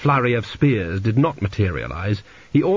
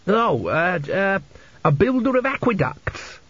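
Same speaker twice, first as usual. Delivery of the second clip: much higher, hesitant then blurted; hasty cover-up; 9 semitones higher, slower than usual. A narrator's reading. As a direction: stumble on fillers, then pitch the answer right up. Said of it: "said two B quickly".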